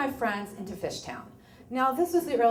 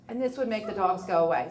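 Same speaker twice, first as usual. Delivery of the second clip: ordinary talk, nothing marked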